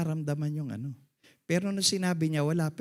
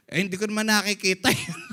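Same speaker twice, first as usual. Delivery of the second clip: shouted punchline; 7 semitones higher, 4 dB louder than usual. Real, not acted.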